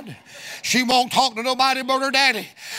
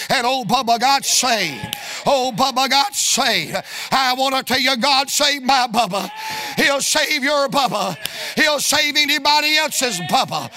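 rising, ever louder revival preaching; preaching crescendo; 4 semitones higher, 4 dB louder than usual. Real, not acted. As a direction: build to a whoop and a high cry. Preacher builds to full force.

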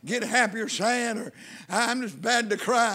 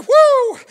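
high, very loud "woo"; reenacted shout of joy, full voice; jubilant whoop; 13 dB louder than usual. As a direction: let out a sudden whoop of praise. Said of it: "I was like".

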